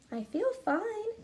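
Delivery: Camilla's answer. Acted. Camilla telling her mother she feels fine, girlish voice. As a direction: answer as a young girl. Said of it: "Camilla answered".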